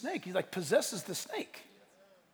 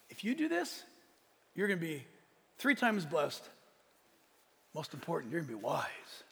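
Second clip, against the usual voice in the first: softer, low, coaxing promise; seductive Satan; 5 semitones lower, 7 dB quieter than usual. Retold or imitated. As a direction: lure her in with a hushed sales pitch. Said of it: "That was one of Satan's lines to her".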